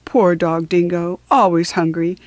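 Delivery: usual speaking voice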